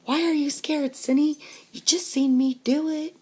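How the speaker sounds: put-on high, loud kid demanding an answer; challenging brother. Brother back from the dip, challenging sister.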